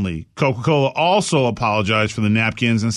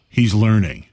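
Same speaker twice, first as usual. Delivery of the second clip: low, grave detective musing; a lightly voiced gruff detective; 5 semitones lower than usual. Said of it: "the detective is like".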